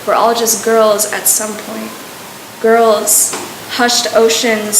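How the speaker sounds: measured, deliberate poetry recital; spoken word poem. Acted. Poet performing for students at their old school, spoken word delivery. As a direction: recite it steadily, each phrase carefully set apart.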